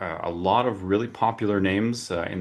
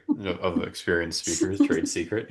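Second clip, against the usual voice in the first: usual speaking voice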